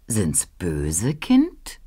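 very slow, low and soft, rising at the end; ominous question. A narrator's reading. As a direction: drop the voice into a quiet, drawn-out threat. Ruprecht asks: good or bad children. (bedrohlich tief, leise und gedehnt)

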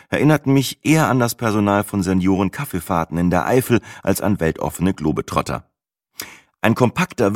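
usual speaking voice

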